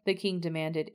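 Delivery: plain reading voice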